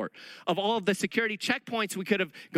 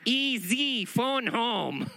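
higher, mock alien croak; lightly voiced E.T; 5 semitones higher, much slower than usual. The speaker jokes that he looks like an ancient alien, in a mock E.T. voice.

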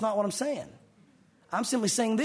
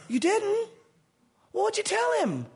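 much higher, disbelieving Peter; incredulous Peter; 12 semitones higher, slower than usual. Retold or imitated. Peter asking Jesus in much higher disbelief. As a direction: squeak out disbelief.

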